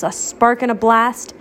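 plain reading voice

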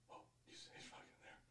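unvoiced hushed whisper. Girl whispering so parents won't hear. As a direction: breathe it out, scared of being heard.

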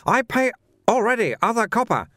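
high-pitched trader in broken English; protesting little shopkeeper. A narrator's reading. Shopkeeper protests to a watchman about paying, high indignant voice.

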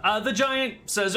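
ordinary talk, nothing marked

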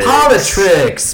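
hammed-up echo of a coinage; mock announcement. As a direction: stretch it slowly in a put-on voice. Echoes the coined name in performance.

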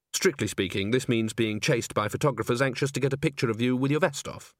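usual speaking voice

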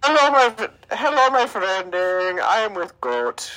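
put-on Indian accent, high and stretched out; mock Indian accent. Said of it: "I hear some Indian accent".

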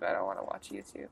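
muttered under the breath; whispered aside. Real, not acted. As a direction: whisper it to yourself. Aside on finding a video.

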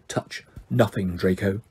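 low, soft, cold aristocrat; low and quiet cold menace; cold stern father. Acted. Cold Mr Malfoy warning son.

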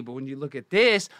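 usual speaking voice

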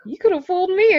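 high, loud disbelieving quip; mock surprised client. Client meeting him in person quips in disbelief. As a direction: play the astonished client.

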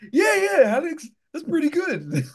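very high-pitched mock cousin voice; high mimicry of an approving relative; mimicking a cousin. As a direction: imitate him in a squeaky-high register. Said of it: "his cousin was like".

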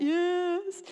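put-on high, drawn-out child yes; whiny little girl. Girl admitting fault to mother, high drawn-out whine. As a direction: imitate a child's reluctant admission.

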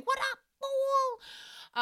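drawn-out high-pitched mock greeting, very slow; hammed-up greeting. Hosts welcome Carrie Lewis to the studio, hammed-up high slow greeting. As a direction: stretch it out in a silly high voice.

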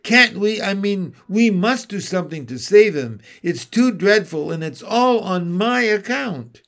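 put-on girl begs the beaver to save her friend; distressed cry. Lucy begs Mister Beaver to save Tumnus, crying out high and loud. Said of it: "cried Lucy".

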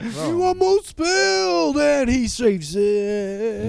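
slow, high replay call; sports commentator. Pretend. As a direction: stretch it like a big replay. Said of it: "You sound like a sports commentator".

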